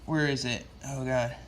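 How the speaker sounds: ordinary talk, nothing marked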